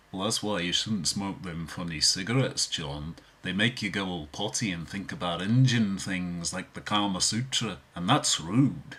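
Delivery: Liverpool accent, chiding tone. Shown as a demonstration. Beatle tells [name] off, Liverpool accent. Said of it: "I can impersonate all four of the Beatles".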